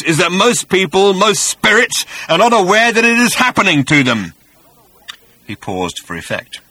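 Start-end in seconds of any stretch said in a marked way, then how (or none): 0.0-4.3 high, loud rasping monster voice; rasping creature. A narrator's reading. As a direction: rasp it out with menace. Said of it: "rasped the voice".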